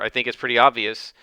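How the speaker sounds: ordinary talk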